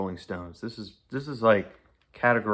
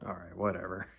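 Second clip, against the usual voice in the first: usual speaking voice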